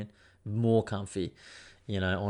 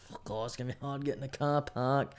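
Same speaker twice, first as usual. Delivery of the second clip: softer, whiny imitation of mum; imitating mum; 3 semitones higher, 5 dB quieter than usual. Son mimicking his worried mum in the car park, softly whining. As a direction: sigh it out as a fretful mum. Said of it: "and she's like".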